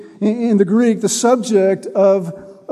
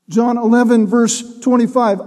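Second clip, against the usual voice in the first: usual speaking voice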